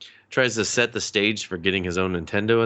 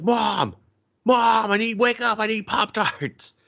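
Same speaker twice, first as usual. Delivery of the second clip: very high imitation of a demanding kid; bratty kid calling Mom; 13 semitones higher, slower than usual. Kid demanding Pop-Tarts from mom, high bratty whine. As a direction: holler up to Mom in a put-on child voice.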